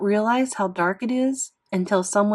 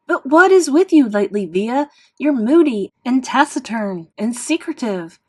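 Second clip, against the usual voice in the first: angry mother's shout, much louder and higher; a mom fed up with her moody daughter yells at her; mom yelling at daughter; 5 semitones higher, 8 dB louder than usual. Acted. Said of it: "I could hear them yelling at each other".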